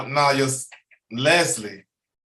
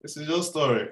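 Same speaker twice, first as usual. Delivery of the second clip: reassuring; 8 semitones higher than usual. Real, not acted. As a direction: ease him through the emotion. Comforting a guest who is emotional.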